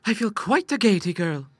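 put-on high, excited girl; gleeful cry. Sister dancing in her short skirt, high gleeful cry. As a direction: exclaim it in delight. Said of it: "she cried".

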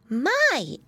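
high-pitched little exclamation; exclaiming piggy boy. A narrator's reading. Piglet reacting to mama's workload, exclaiming. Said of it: "exclaimed Flop Ear".

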